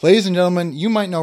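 big playful emcee opening; mock announcer. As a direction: announce it grandly to a crowd. Mock announcement of a famous quote.

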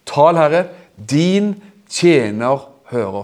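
drawn-out, slow reverent words; slow prayer. Acted. Eli models the prayer slowly for Samuel. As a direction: say it slowly, savouring each word. Repeated slower.

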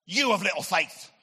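loud, stern rebuke; acted furious scolding; angry voice. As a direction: thunder it out like an angry God. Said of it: "Why do we always give God an angry voice?".

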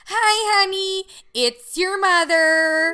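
high, loud, slow mother's voicemail; voicemail mom. Retold or imitated. Daughter mimicking mom's voicemail: high, slow, loud. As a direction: drag it out like a sing-song voicemail. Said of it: "It's like when she leaves a voicemail".

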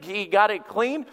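ordinary talk, nothing marked

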